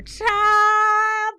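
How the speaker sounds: long, loud, high cry; excited outcry. Real, not acted. Recapper reacting to the first traitor found, excited outcry. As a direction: belt it out and stretch the word. Exclaiming at the room's eruption.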